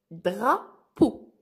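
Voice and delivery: "Drapeau" slowly split in two, with a clear pause between Dra and peau; syllable by syllable. Shown as a demonstration. Teacher splits word into syllables.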